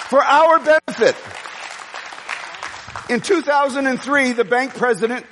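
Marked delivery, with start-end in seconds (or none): 0.1-1.1 emphatic repeat. Real, not acted. Preacher repeats phrase for emphasis.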